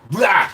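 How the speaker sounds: short, high, loud rah; sudden imitated roar; angry roar. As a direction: bark it at him like Hyde. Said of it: "and he's like".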